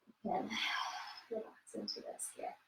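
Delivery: breathy, calm whisper; soft spa whisper. Acted. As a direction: whisper to help them let go. Instructor cueing relaxation.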